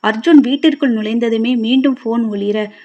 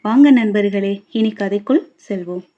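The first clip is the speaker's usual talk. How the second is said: ordinary delivery, nothing marked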